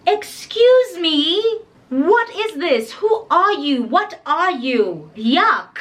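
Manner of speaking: high, drawn-out incredulous questioning; mock disgust. Friend recoils from a dark-skinned child, mock disgust. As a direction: act out appalled disbelief, slowly.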